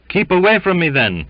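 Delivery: high, loud, petulant rebuff. A narrator's reading. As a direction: push him off with the voice. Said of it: "giving Belfast a petulant push".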